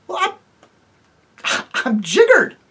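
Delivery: laughing amazement. Acted. Eustace marvels at Jill, laughing loudly. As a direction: laugh through the surprise.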